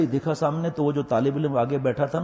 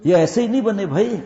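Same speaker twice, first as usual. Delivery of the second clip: emphatic; 6 semitones higher, 5 dB louder than usual. Real, not acted. Preacher stresses point.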